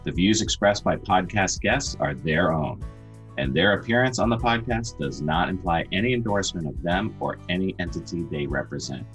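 brisk legal disclaimer read; fast legal disclaimer. Acted. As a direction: rattle off the fine print. Disclaimer read over music.

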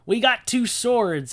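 high, loud mock-heroic boasting; hammed-up, high-pitched gung-ho excitement; overeager disciples. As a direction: announce it proudly, cluelessly confident. Acts cocky, clueless disciples.

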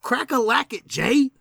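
high, sing-song chant; excited call. Acted. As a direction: chant it up high to cue Jay. Calling Jay to start, chanted.

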